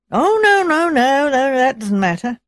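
high, loud protest falling back down; hasty protest. A narrator's reading. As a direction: wave the offer away at once, voice leaping up. Visitor hastily refuses to wait for cleaning.

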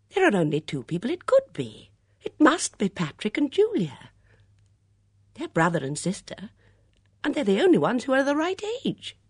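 high, loud, emphatic reasoning; excited deduction. A narrator's reading. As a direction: press your theory eagerly. Bunch excitedly names suspects.

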